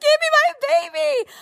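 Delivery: very high-pitched retold wail; desperate mother's plea. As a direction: beg frantically for your child, way up high. Retold plea while child screams.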